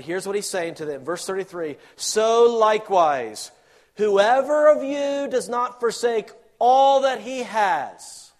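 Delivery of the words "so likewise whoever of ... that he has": loud, high, slow scripture proclamation; preaching scripture at full force. Acted. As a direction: proclaim the verse like thunder.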